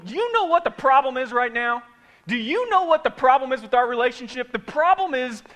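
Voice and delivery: loud, high, confrontational question; heated question. Shown as a demonstration. Preacher models arguing with spouse, loud and high. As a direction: demand it with heat.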